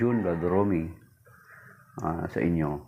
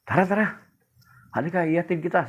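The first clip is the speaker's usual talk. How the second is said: urgent priest offer; 8 semitones higher, faster than usual. Acted. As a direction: rush the child along. Priest offers to walk child home.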